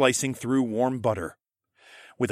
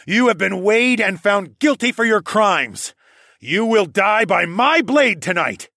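loud, raised voice passing sentence; solemn judgement; 9 semitones higher, 8 dB louder than usual. Acted. Hero condemns the villain to death, loud and judging. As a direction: pronounce the verdict, high and forceful.

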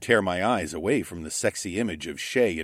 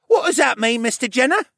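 put-on high, louder boyish question; curious boy; 7 dB louder than usual. A narrator's reading. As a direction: ask it like an eager kid. Boy voiced asking.

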